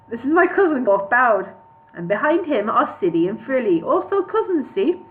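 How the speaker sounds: singsong attempt at a Welsh dwarf; bad Welsh accent. Dwarf presenting his cousins, bad Welsh accent. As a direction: rattle off the introductions in mock Welsh. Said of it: "I can't do a Welsh accent".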